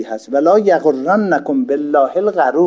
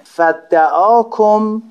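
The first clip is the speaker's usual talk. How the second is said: slow recitation; 7 semitones higher, 4 dB louder, much slower than usual. Shown as a demonstration. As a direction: stretch every syllable of فدعاکم, higher and louder. Stretched Arabic recitation of text. (قرائت کشیده و آهسته)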